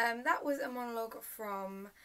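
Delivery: usual speaking voice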